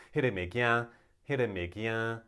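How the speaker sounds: "这个物件" in Taiwanese with a nasal last vowel. Shown as a demonstration. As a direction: draw out "物件" through the nose. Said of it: "It's very nasal".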